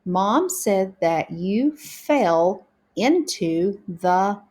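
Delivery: slow beginner reading, breaking words into sounds; practicing together. Shown as a demonstration. Teacher practicing sentences together with pupils, slow sounded-out reading. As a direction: read word by word, blending "fell" and "pond". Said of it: "let's practice together now".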